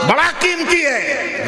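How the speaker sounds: high, ringing, drawn-out preacher's cry; fervent sermon proclamation; preaching at full force. Acted.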